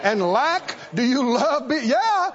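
high, ringing preacher's call to the congregation; preaching at full force. Acted. Preacher urging the church to magnify the Lord, full force. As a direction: lift it up and preach it out.

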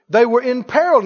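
ordinary delivery

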